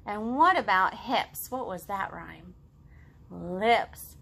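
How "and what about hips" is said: ordinary talk, nothing marked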